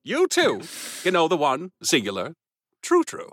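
high, bright Mid-Atlantic announcer; radio announcer. Pretend. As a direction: sell it like an old-timey radio ad. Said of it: "Mid-Atlantic radio announcer".

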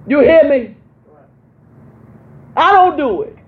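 high, loud call to the church; preacher's shout. Real, not acted. Pastor calling to her church, loud and high. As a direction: belt it out so the back row hears.

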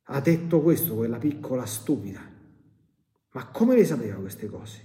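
ordinary talk, nothing marked

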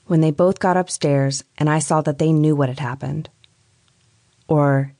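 usual speaking voice

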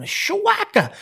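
sudden high-pitched "shawaka" sound effect; imitated misfire noise. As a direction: act out the bow going off with a high burst.

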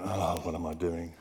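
low, soft self-reproach, acted out; mortified mutter. Man sitting down after his shout in church, mortified mutter. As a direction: grumble privately, sunk in embarrassment.

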